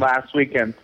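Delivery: ordinary talk, nothing marked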